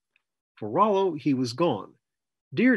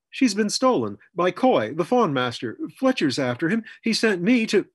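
high, loud, urgent outpouring; urgent report; 7 semitones higher, 5 dB louder than usual. A narrator's reading. Rollo tells Chiswick Zelda is stolen, urgent and distressed. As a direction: blurt out the bad news, pitched up.

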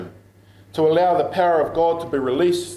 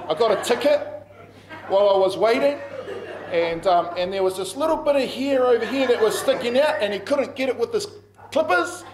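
laughing; 6 semitones higher than usual. Real, not acted. Funny haircut story.

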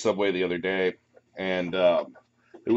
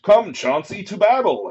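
knight's battle cry; 11 semitones higher, 9 dB louder, slower than usual. Acted. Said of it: "he screams".

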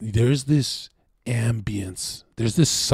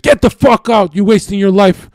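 loud, high furious shouting, barely holding back; furious yell; 8 semitones higher, 8 dB louder than usual. Retold or imitated. Twin brother throwing him out, furious loud yelling. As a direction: yell him out of the house for good. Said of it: "He's like".

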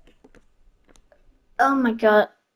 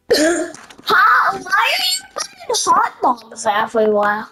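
ordinary delivery

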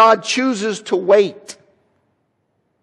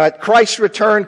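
ordinary delivery

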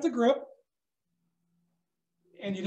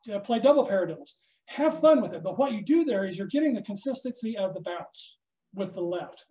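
ordinary talk, nothing marked

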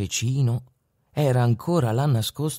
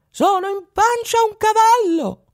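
tiny voice; 4 dB louder than usual. A narrator's reading. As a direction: speak like a tiny boy answering from inside a horse. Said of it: "rispose una vocina". (vocina acuta e minuta)

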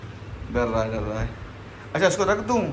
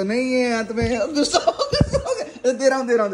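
laughing while teasing the dog, voice jumping higher; 6 semitones higher than usual. Real, not acted. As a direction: giggle through the words as the dog gets cross. (हँसते हुए कुत्ते को चिढ़ाना)

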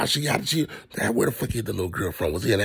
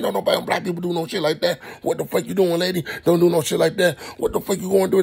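scolding the screen; 8 semitones higher than usual. Real, not acted. Yelling at movie character.

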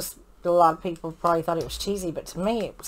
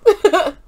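loud nervous laugh; laughing at a scene, loud nervous laugh; 10 semitones higher, 7 dB louder than usual. Real, not acted. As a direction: burst out laughing.

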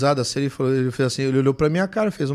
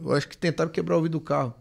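usual speaking voice

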